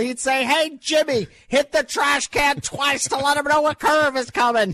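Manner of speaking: put-on high-pitched old-timer telling a story; old guy voice. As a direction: spin the yarn like an old ballplayer. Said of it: "I like your old guy voice".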